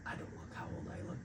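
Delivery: barely voiced, hesitant self-talk; quiet acted doubt. Actor looking at himself in costume, quiet doubt. Acts out doubting own looks.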